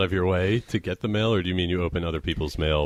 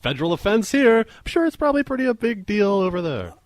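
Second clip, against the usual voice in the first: excited. Real, not acted. Mock outrage after laughing.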